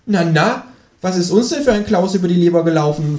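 high creature voice, chiding; Känguru. A narrator's reading. Kangaroo chides sulking companion, high teasing voice. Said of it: "fragt das Känguru".

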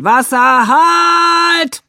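loud high shout; firemen shouting. Acted. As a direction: yell it across the fire scene. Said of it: "Hat die Feuerwehr geschrien". (Feuerwehr schreit laut und hoch)

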